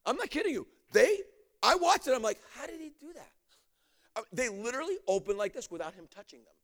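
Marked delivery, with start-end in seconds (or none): 2.6-3.3 high, very soft astonished aside; amazed hush. Retold or imitated. Minister watching doors open by themselves, hushed amazement. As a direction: wonder aloud under your breath.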